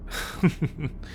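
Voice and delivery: short, soft chuckle; brief breath of laughter; amused chuckle. Acted. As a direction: chuckle quietly at a memory. Amused before fond war story.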